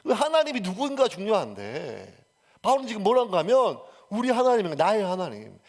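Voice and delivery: normal talking voice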